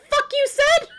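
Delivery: squeaky high, snotty put-on voice; mock character voice. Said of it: "Christmas present's like".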